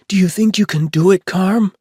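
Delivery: put-on very high, loud child's voice; a little kid desperate to be chosen; eager little girl. A narrator's reading. Rosie pleading with Chuck for the hatchet, high loud child. Rosie asking her sister.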